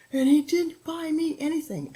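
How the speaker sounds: put-on high, loud whiny kid voice; upset child. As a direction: wail it like a hurt eight-year-old. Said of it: "He was like".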